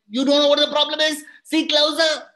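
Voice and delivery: pitched well up and fast, pushing the officers; a dramatic leader's urgent challenge; high excited Kalam. Acted. Kalam challenges his security officers, high and fast. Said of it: "And Abdul Kalam said".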